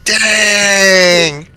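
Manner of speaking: put-on a stretched, excited little kid's dang. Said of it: "she was like".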